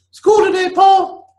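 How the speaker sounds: very high, loud calling mother; mother's voice, redone. Acted.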